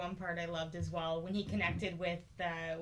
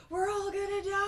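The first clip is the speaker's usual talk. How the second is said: high-pitched alarmed cry; mock panic; 12 semitones higher, 4 dB louder than usual. Buddy sees what's going on, mock panicked cry. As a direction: imitate a panicking kid, pitched way up.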